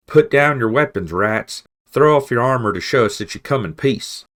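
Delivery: high, loud shout from the wall. Acted. Badger Constance ordering rats to disarm, full shout. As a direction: bellow the order down at them. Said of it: "Constance shouted".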